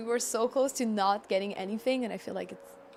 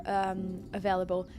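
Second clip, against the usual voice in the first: normal talking voice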